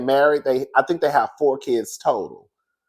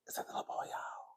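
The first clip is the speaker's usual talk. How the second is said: breathy gossip whisper, much softer; 9 semitones lower, 18 dB quieter, faster than usual. Real, not acted. Wondering aloud to viewers if it's the boy, whispering. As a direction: whisper like sharing a secret. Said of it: "I don't know why I'm whispering".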